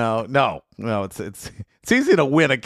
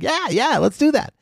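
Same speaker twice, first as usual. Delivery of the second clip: much higher, overeager yes-man; eager yes; 13 semitones higher than usual. Retold or imitated. Guest recalling agreeing to ref wrestling, overeager and high. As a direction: chirp it like you'd agree to anything. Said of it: "I'm like".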